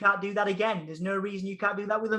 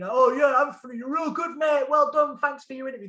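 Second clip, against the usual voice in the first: put-on broad Hull accent, pitched higher and louder; 6 semitones higher, 4 dB louder than usual. Praising Anthony as a mock guest in a broad Hull accent. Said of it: "That was my proper Hull accent".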